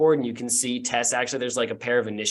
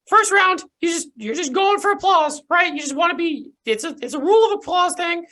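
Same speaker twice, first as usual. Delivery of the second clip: very high, bright announcer figure; squeaky eager host laying out rules; high character voice; 5 dB louder than usual. Acted. GM voices Pantomima.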